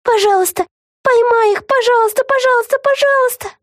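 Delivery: very high, anxious pleading; repeated pleading. A narrator's reading. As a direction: beg over and over in a squeaky voice. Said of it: "повторяла она про себя". (тонкая тревожная мольба)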